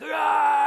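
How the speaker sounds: high loud "Ah!" cry; mock yell. Handcuffed man yelling up at the jetway, loud cry. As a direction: let out a sudden yelp upward. Prisoner looks up, yells.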